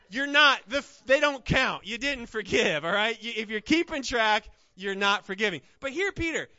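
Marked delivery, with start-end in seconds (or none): 0.1-3.2 loud, high, emphatic preaching; preaching shout. Real, not acted. Preacher shouting at his church that counting isn't forgiving. 3.2-5.6 louder, driving repeat of the point; loud emphatic repeat. Real, not acted. Preacher repeating to his church, now loud.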